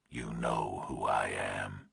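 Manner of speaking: slow, low, menacing whisper; distorted nightmare voice. A narrator's reading. Kidnapper on the phone to Burke, slow eerie whisper. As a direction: breathe it like a monster on the line.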